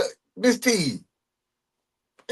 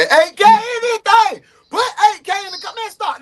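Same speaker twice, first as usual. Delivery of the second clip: high, loud celebratory yell; real hyped shout; 11 semitones higher, 5 dB louder than usual. Real, not acted. Streamer celebrates with viewers at a milestone, shouting. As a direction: shout it high, rallying the crowd.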